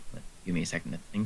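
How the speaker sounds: hushed, quick murmur to self; whispering. Real, not acted. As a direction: talk under your breath while thinking. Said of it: "Are you whispering 'cause there are people nearby?".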